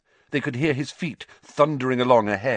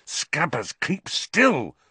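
hissed; 7 semitones higher, slower than usual. A narrator's reading. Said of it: "Ron hissed".

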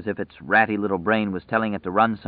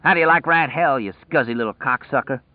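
raised, jeering call; taunting cry; 5 semitones higher than usual. A narrator's reading. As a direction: call back a crude taunt. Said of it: "looked back over his shoulder and cried".

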